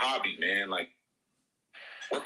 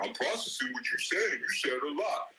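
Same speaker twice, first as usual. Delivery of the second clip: mocking imitation; 2 semitones higher than usual. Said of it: "They say stupid stuff like that".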